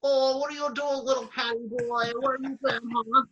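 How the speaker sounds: high, cooing voice to a pet cat; cat baby talk. Retold or imitated. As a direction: coo at the cat like a doting owner. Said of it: "being like".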